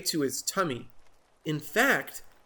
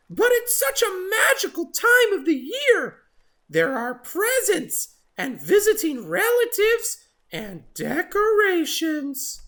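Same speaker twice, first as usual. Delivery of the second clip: chirpy excited bird; 5 dB louder than usual. Acted. Oxpecker gushing to Jim about Christmas, very excited. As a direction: gush like a tiny thrilled bird.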